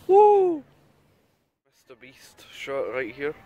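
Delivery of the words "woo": loud high whoop. Real, not acted. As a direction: cheer out loud.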